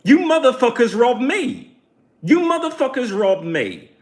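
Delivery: high, loud mock outburst; shouting at the thieving neighbors; retold yell. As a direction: yell it at the whole building, half acted. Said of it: "I'm like".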